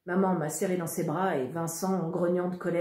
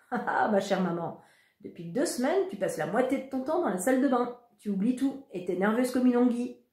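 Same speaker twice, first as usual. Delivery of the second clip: smug teasing; 6 semitones higher, 3 dB louder than usual. Acted. Brother teasing mother in love.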